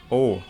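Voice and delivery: close, rounded "O", pushed out; bassy rounded O. Shown as a demonstration. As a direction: say "O" narrow-mouthed for a bassy sound. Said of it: "biar ngebas".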